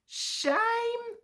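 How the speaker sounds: high, soft squeaky voice; squeak. A narrator's reading. Percy butts in at the Fat Controller, small squeak. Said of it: "squeaked Percy".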